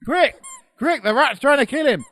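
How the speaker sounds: high, loud, frantic commands; urgent shouting. Real, not acted. Podcaster calling Oracle for music as rat attacks, frantic shout. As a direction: bark urgent orders over chaos.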